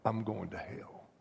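low, soft echo of the man's cry; subdued retold reply. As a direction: say it quietly, as a grave retelling. Retold sole response, said low.

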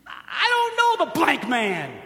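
slow, loud, high hammed-up oath; cursing denial. Preacher acting Peter swearing he doesn't know Jesus, slow and loud. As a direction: spit out each word like a curse. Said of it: "He cursed".

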